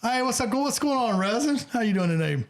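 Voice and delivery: a cheery, welcoming Southern greeting; Southern waitress. Retold or imitated. A waitress greeting customers in Pigeon Forge, with Southern charm. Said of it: "Southern charm".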